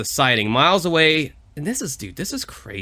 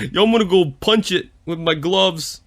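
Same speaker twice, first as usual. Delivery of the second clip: lightly voiced dim boxer; Rocky impression; 3 semitones higher than usual. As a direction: brag like a punchy prizefighter. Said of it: "You're trying to do Rocky?".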